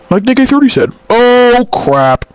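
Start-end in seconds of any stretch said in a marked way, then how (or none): none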